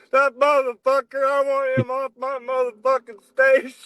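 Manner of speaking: high mock outraged yell; imitating the stage manager losing it; retold yell. Stage manager raging at the singer, high mock yell. Chris yelling about Bill onstage.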